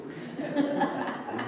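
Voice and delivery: high breathy laugh mid-impression. Real, not acted. Preacher laughing while retelling the innkeeper's greeting.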